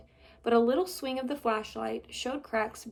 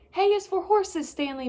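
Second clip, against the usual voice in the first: calling back; 8 semitones higher, 6 dB louder than usual. Acted. Mother calling back correcting.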